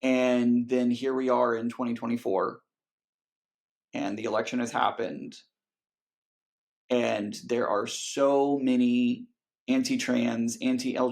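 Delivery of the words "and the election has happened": ordinary delivery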